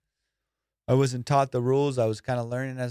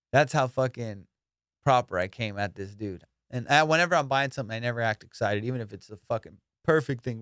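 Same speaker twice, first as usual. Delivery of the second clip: ordinary talk, nothing marked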